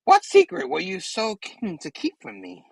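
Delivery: high, probing villain voice; Goblin. Acted. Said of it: "Goblin was like".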